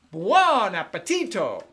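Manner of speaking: slow, loud Italian "Buon appetito" with a flourish; Italian exclamation. Shown as a demonstration. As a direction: announce the meal like an Italian host. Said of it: "you might say".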